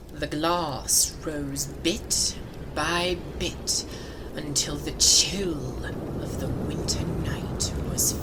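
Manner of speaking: ordinary delivery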